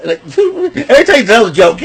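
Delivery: high cackling laughter through the words; laughing hard. Real, not acted. Mocking Solo Sikoa's jokes, laughing high and hard. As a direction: laugh helplessly while mocking his jokes.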